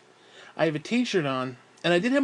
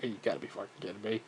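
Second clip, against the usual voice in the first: muttered disbelief; 7 semitones lower, 12 dB quieter, much faster than usual. Retold or imitated. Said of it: "and I'm like".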